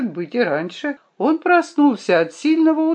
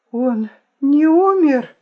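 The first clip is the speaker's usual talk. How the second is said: high, loud, drawn-out plea; slow imploring question, pitched well up; pleading; 7 semitones higher, 6 dB louder, slower than usual. A narrator's reading. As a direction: beg for the answer, voice lifting anxiously. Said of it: "спросила я с мольбой в голосе".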